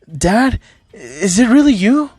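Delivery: shocked. A narrator's reading. Izuku recognises his long-lost father, shocked. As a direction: lift the pitch in disbelief. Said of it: "Izuku shocked would say".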